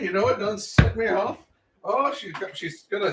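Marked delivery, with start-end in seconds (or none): none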